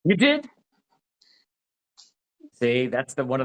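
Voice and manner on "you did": sharply higher, louder "you did?"; surprised. Real, not acted. Hearing a friend ate marzipan today, delighted surprise. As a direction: catch her out in happy surprise.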